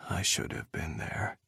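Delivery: guilty and low. A narrator's reading. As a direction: let the guilt sink the voice. Ethan breaks down guiltily.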